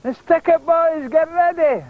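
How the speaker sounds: high, drawn-out rallying call; slow, high-pitched street holler; gang rallying shout. Retold or imitated. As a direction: shout to muster the boys. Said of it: "then we would shout".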